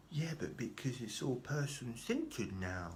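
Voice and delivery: put-on social worker. Social worker explaining to her client, mockingly drawn out. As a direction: draw it out in a put-on caseworker voice. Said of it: "She didn't say it like that".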